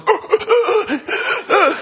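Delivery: high, breathless gasp of shock; overwhelmed gasp. Retold or imitated. A fan gasps when her idol walks in. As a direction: gasp as if meeting your idol.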